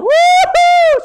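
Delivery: very high, loud "woo-hoo"; hammed-up squeal of joy; joyful whoop. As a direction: let out a piercing celebratory whoop. Sarah's joy acted out.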